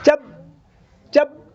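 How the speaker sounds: "चप" high, cut short on the प; abrupt stop. Shown as a demonstration. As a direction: halt dead at the end of each "चप". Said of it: "यहाँ पर आप रुकिए". ("चप" पर झट से रुकें)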